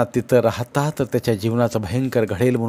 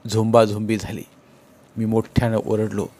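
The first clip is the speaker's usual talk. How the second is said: plain reading voice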